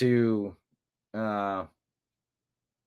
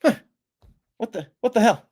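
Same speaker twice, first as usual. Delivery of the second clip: higher, exasperated outburst; griping about an impression, exasperated and higher; 8 semitones higher, 4 dB louder, faster than usual. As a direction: throw up your hands in disbelief.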